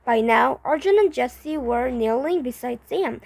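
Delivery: ordinary delivery, nothing marked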